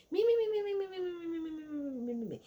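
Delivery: high rapid mimicry, tumbling down and fading; mocking me-me-me. Sutton making the joke about herself, mocked as babble that fades. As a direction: start high and loud, trail down mockingly. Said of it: "that's what it really came off like".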